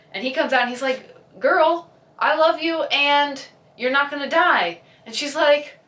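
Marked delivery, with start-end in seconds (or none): 1.4-4.8 mock heartfelt declaration. Boyfriend's declaration.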